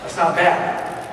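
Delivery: ordinary talk, nothing marked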